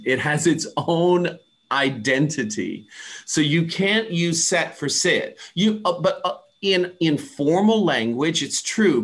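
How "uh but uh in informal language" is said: usual speaking voice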